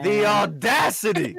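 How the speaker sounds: slow, loud, scandalized gasp of a line; mock outrage. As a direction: play it as theatrical indignation. Mock outrage at being called out.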